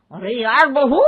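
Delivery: ordinary talk, nothing marked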